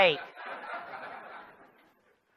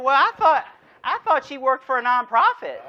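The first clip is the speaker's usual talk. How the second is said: higher, louder mock-irritated thought; a hammed-up flash of annoyance, pitched up; 5 semitones higher, 5 dB louder than usual. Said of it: "you're getting a little irritated".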